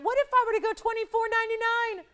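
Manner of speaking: spring the new price on them with a thrill; excited price reveal. Real, not acted. Price drop reveal, excited pitch.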